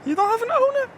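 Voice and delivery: squeaky praising a dog. Real, not acted. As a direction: coo at the dog. Baby talk to the dog.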